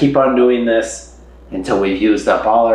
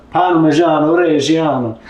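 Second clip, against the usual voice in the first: slow, higher Italian-accented flourish; 7 semitones higher than usual. Shown as a demonstration. Enjoying the cheese's name on camera, Italian accent. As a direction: savor it in a playful Italian accent. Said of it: "fun to say with an Italian accent".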